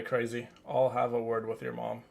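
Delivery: usual speaking voice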